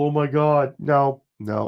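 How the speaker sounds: ordinary talk, nothing marked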